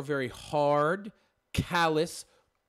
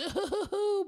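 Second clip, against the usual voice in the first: goofy falsetto laugh; 13 semitones higher, 6 dB quieter than usual. Pretend. Mocking Quiznos sub-card handout guy.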